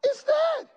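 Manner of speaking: high cracking wail on "Is dead"; hammed-up wailing "dead". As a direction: let "dead" break into a mock sob. Repeats with a cry.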